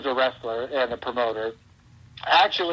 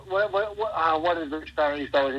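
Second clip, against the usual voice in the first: ordinary talk, nothing marked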